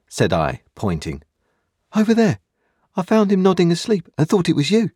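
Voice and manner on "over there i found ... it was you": put-on higher, louder eager boy, quick; a boy rushing to explain himself; young boy Pip. A narrator's reading. Boy Pip's voice.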